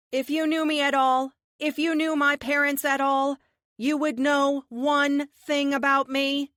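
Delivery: loud, high, slowing defiance; defiant declaration. Acted. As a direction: proclaim it with fierce resolve. Heroine's defiant speech.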